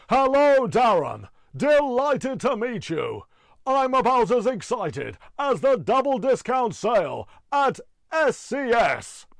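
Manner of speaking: huge, high booming announcer voice; over-the-top arena announcement; X Factor announcer. Acted. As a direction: bellow it with stretched-out showbiz hype. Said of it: "guy that does the announcements on X Factor".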